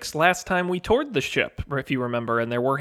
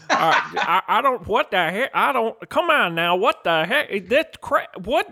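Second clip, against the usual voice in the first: put-on high, flustered old-timer twang; sputtering confused prospector; 8 semitones higher than usual. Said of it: "You Hank Hill having a stroke?".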